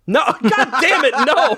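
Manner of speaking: high-pitched laughing exasperation; exasperated, laughing refusal; laughing protest. Real, not acted. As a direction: protest through your own laughter. Nearly tricked, protests while laughing.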